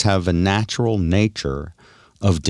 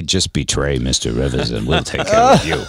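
lower, sly offer; mock shady boss; 7 semitones lower than usual. Host voicing the manager tempting Zip, lower and sly. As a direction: play the shady manager making a deal.

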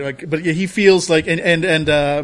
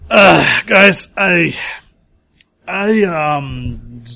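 nervous stammer; 2 semitones higher, 8 dB louder, much slower than usual. Acted. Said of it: "Cohen's like".

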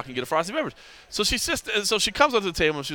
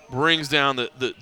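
usual speaking voice

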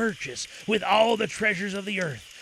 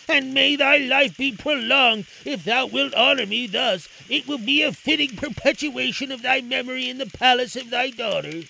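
Aladdin voice; 6 semitones higher, 5 dB louder than usual. A narrator's reading. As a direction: address the king reverently.

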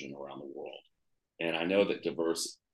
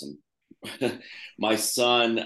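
laughing; 5 semitones higher, 5 dB louder, much slower than usual. Real, not acted. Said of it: "I have the hiccups".

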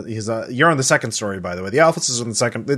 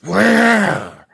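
higher, louder angry whispered yell; 10 semitones higher, 5 dB louder than usual. Acted. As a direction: spit it out as a furious whisper-shout. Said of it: "It's an angry whisper".

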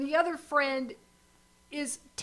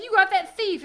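indignant king; 6 semitones higher, 7 dB louder than usual. Retold or imitated. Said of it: "He's like".

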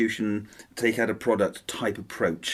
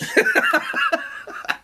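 high burst of laughter; 3 dB louder than usual. Real, not acted.

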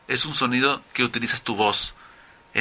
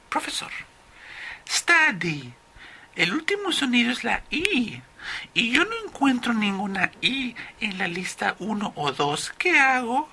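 confused student; 11 semitones higher than usual. Acted. Student asks the professor which list to use, high confused student voice. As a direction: play a puzzled student in falsetto.

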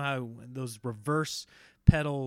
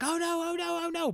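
imitating a panicked little boy, much higher; mock-panicked child voice, pitched way up; panicking kid. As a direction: squeak it out like a scared kid. Son panicking, feet slipping off pedals.

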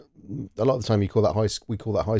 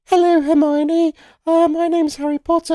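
put-on young boy voice; 6 dB louder than usual. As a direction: mimic the young child actor. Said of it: "And he starts off with, like".

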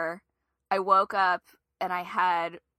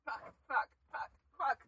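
hushed panic; 4 semitones higher, 8 dB quieter, faster than usual. Real, not acted. Hiding from spider.

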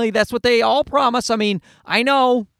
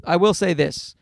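ordinary delivery

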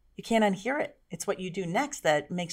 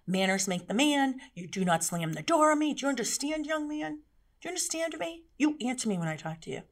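angry dressing down; 7 semitones higher than usual. Shown as a demonstration. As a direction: scold the boy like a strict mother. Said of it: "both versions of Janine".

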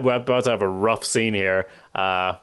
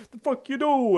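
much higher put-on macho bark; macho outburst. Tony's macho outburst at A J, a higher mock bark. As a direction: act out a tough-guy father. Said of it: "machismo of, like, going like".

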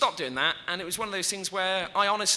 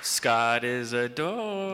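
lower, very slow deadpan catchphrase; 6 semitones lower, much slower than usual. Acted. Offers a catchphrase, drawn out very slowly. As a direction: drag out each word deadpan. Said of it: "do you want a catchphrase".

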